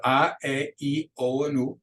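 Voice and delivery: A, E, I, O, U drawn out as Spanish vowels. Shown as a demonstration. Teacher listing the five Spanish vowels, A, E, I, O, U in slow Spanish. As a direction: sound out A, E, I, O, U slowly in Spanish. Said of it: "the five Spanish vowels".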